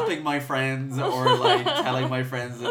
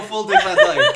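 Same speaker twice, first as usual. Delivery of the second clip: laughing; 13 dB louder than usual. Real, not acted. Laughing at her botched accent.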